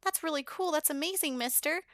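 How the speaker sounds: put-on very high, impressed child voice; little kid. Liliana watches Vlad turn into a bat, childlike awe. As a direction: marvel like a small child. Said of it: "she's a kid".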